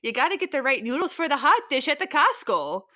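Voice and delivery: a hint of a Minnesota voice; Minnesota accent. Host answers with a light Minnesota accent about hot dish. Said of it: "insulting accent for Minnesotans".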